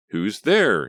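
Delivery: called out loudly into the dark; nervous call into the night. A narrator's reading. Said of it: "she called".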